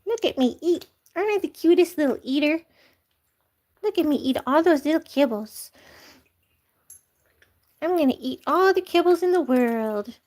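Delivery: high, playful kitten voice; the cat Lucky boasting while eating. Pretend. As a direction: show off like a greedy kitten. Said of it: "Lucky, he's taking over".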